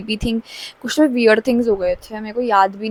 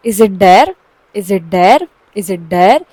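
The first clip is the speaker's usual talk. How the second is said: louder retold question, rising at each end; insistent repeated questioning; 3 semitones lower, 5 dB louder, much slower than usual. Teller keeps asking the friend if it's a dare, insistent retelling. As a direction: press the same question over and over. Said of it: "चार पांच बार बोले जा रही हूँ".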